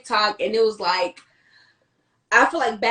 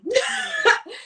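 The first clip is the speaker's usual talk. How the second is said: a high, bright laugh breaking out; sudden real laugh; burst of laughter. Real, not acted. Laughs while starting the story.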